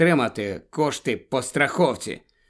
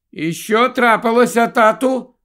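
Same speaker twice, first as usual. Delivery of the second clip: put-on high child's voice, raised throughout; questioning boy; 8 semitones higher, 4 dB louder than usual. A narrator's reading. Boy interrupting his game to ask dad, high and questioning.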